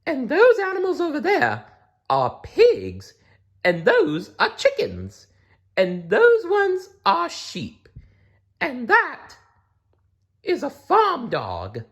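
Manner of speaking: high cow voice pointing things out. Acted. The cow names the farm animals for Blinky, high voice. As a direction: show him round in a high voice. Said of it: "said the cow".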